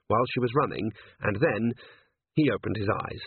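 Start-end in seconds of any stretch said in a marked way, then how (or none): none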